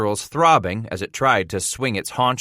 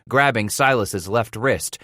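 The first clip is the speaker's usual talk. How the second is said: ordinary delivery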